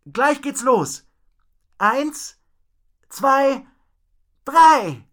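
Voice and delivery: high, loud, drawn-out countdown; slow, high holler; calling out. A narrator's reading. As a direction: call the count so it carries far. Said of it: "rief Rocco".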